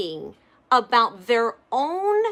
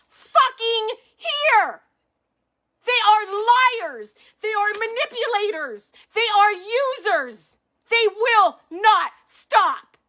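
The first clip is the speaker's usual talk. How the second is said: near-tearful furious shout, higher; fired up angry; 6 semitones higher than usual. Real, not acted. Accusing the company of lying, tearful fury. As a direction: break toward tears while yelling. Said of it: "I'm fired up".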